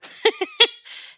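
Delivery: squealing laugh, way up high; real laugh at the joke title; high burst of laughter. Real, not acted.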